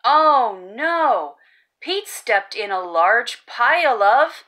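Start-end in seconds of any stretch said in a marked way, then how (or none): none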